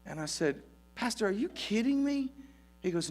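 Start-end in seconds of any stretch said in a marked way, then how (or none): 1.0-2.3 high, disbelieving plea; incredulous. Retold or imitated. Young assistant pastor protesting to his pastor, incredulous. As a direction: ask it as if he can't believe the request.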